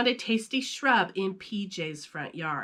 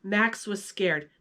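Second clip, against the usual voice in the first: usual speaking voice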